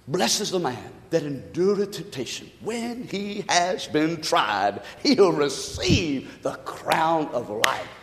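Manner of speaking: slow, soft reading of a verse; hushed, unhurried scripture read. Acted. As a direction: read the verse gently and slowly. Reads scripture slowly, softly.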